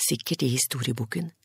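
ordinary delivery

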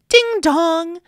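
ordinary delivery, nothing marked

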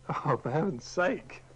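usual speaking voice

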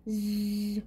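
a soft low snore; snoring. Acted. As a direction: snore quietly in deep sleep. Character sleeping.

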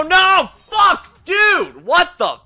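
real furious yelling at the game; high, loud frustrated cursing; angry outburst. Real, not acted. Gamer raging at the boss, yelling high and loud. Player dies in boss fight.

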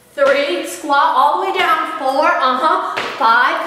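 normal talking voice

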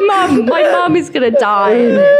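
high laugh-crying words. Real, not acted. [name] laughing about her mum hearing the joke. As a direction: squeal it through laughter.

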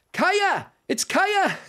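high, excited cry of recognition; delighted recognition. Real, not acted. Player recognising the rescuer, delighted cry. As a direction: call the name out in delight.

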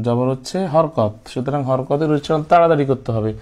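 ordinary delivery

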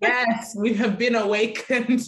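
laughing through the words; real delighted laugh while agreeing. Real, not acted. Laughing along with the guest's joke.

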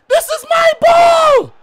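high, loud, stretched wail of frustration; anguished yell. Real, not acted. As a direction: cry out like the ball was stolen. Gamer losing the ball again.

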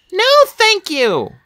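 high, slow, emphatic character voice; drawn-out, decided high-pitched refusal; firm refusal. A narrator's reading. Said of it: "Sunny said firmly".

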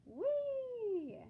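high squeal of delight; gleeful whee. Acted. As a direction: let it fly up high like a frisbee. Frisbee throw squeal.

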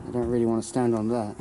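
ordinary delivery

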